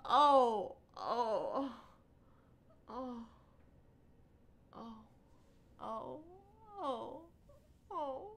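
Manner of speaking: soft, high moans after laughing; weak recovering sighs; spent groans. Real, not acted. Winded after laughing fit.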